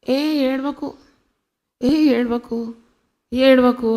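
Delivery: lightly acted "there, don't cry"; brisk mock consoling. Preacher imitating people wiping tears, brisk hushing. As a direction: imitate someone hushing a crier.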